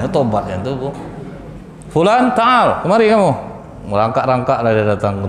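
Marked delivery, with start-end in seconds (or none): 1.9-3.3 calling out. Acted. Prophet calling the repentant man forward, raised. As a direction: call him over, higher and raised.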